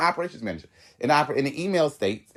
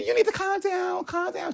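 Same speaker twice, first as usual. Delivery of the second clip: very high mimicking voice; mock someone telling you to calm down, way up high; squeaky-high retold scolding; mimicked calm-down; 3 dB quieter than usual. Mimicking manager telling her off.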